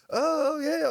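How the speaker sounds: high, hammed-up oh yeah; mock surprised oh yeah. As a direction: squeal it like a nosy guest. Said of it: "Everybody's like".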